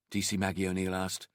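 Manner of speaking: plain reading voice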